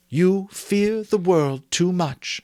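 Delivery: slow it right down, tender; gentle. A narrator's reading. Belle gently warning Scrooge about his fear, slow and gentle. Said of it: "she answered gently".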